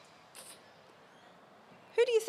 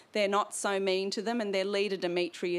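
ordinary delivery, nothing marked

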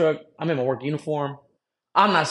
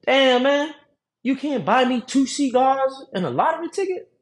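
mock old lady; 11 semitones higher than usual. Old lady demanding free cigars from teller, mock whiny and high. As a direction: play the lady pleading high and offended. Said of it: "then the lady's like".

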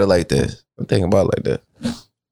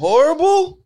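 very high, disbelieving question; shocked falsetto query; incredulous echo. Real, not acted. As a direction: squeal the word back in disbelief. Echoes other's word in disbelief.

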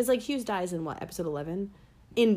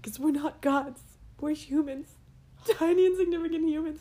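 imitating a crying character; 9 semitones higher than usual. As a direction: voice a character breaking down. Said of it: "at the end when he was like".

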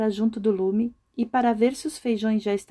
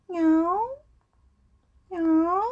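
higher rising mock meow; playful cat meow, higher; 6 semitones higher than usual. A narrator's reading. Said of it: "Passou um gato".

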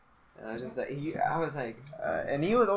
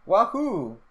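higher-pitched retold "wahoo"; imitated wahoo call; 7 semitones higher, 4 dB louder than usual. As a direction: mimic a sudden call from behind. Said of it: "I just hear".

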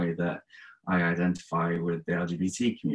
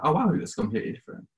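higher, fast mock amazement; retold surprise; 10 semitones higher, faster than usual. Host retelling his reaction to the guest's hair, quick surprised exclaim. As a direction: blurt it out quickly as if caught off guard.

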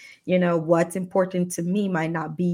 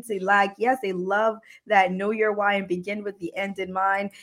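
ordinary talk, nothing marked

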